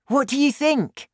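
put-on excited boy laying out his plan; lightly voiced boy, thrilled with his idea; young Danny. Danny asks eagerly.

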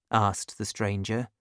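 ordinary delivery

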